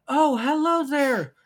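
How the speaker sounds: loud, very high, drawn-out hello; mock a failed British accent by just pitching up. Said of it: "just you raising the pitch of your voice".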